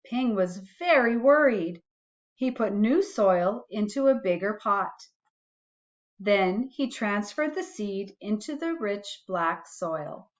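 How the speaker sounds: put-on gentle read-aloud for a child; reading a bedtime story to a little one; storybook reading. A narrator's reading. Parent reading a picture book to a child, gentle storytelling.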